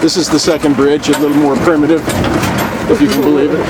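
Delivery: talking while bouncing on a rough road; on a bumpy road. Real, not acted. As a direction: voice jolted by a bumpy ride. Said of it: "sorry about the shaking".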